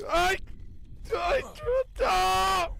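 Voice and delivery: high, drawn-out shrieks of fear; terrified screaming. Real, not acted. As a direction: scream as you die. Dying in game, screaming.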